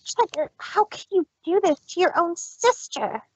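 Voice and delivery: high, hurt plea; wounded sister's plea; hurt and broken. Acted. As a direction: let the betrayal crack through. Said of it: "sounding rather hurt and broken".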